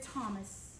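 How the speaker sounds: soft, gentle reassurance; Jesus softly reassuring doubting Thomas; tender. Retold or imitated. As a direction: speak softly, as if comforting a friend. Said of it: "Jesus walked up to Thomas and said".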